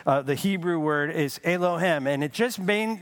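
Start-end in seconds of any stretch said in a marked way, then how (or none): none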